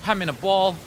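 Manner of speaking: normal talking voice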